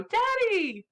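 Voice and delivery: put-on high, loud little-kid call; small child's voice. A narrator's reading. Small daughter running up the path to her father, child's call. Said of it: "a very small voice go".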